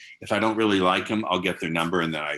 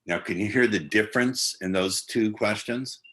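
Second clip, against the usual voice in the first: ordinary talk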